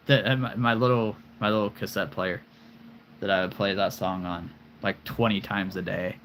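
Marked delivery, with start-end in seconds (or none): none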